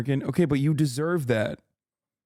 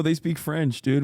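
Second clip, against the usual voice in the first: normal talking voice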